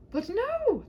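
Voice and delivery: high, loud cry of protest; knight cries out. Acted. Knight crying out to the princess, high and loud. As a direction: cry out in dismay. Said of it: "the knight cried".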